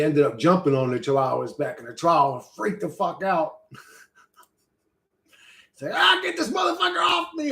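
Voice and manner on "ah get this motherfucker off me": high-pitched frantic screech; mock panicked yell. Chihuahua with zombie man on its back, mock panicked screech. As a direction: yelp in hammed-up alarm.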